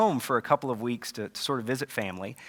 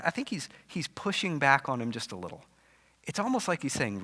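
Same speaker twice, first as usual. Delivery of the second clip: ordinary talk, nothing marked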